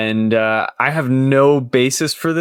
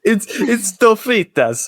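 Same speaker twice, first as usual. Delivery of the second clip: high, slow mock-Italian lilt; Italian accent; 10 semitones higher than usual. Podcaster naming a burrito filling, Italian accent. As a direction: stretch it out in a playful Italian accent. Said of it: "saying that with an Italian accent".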